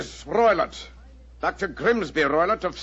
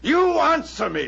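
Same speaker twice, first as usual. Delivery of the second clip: angry demand; 8 semitones higher, 3 dB louder than usual. Acted. Roylott bullying Holmes.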